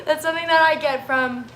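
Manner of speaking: tearful, voice breaking; laughing through tears; crying. Real, not acted. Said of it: "I did not think that I was gonna be crying today".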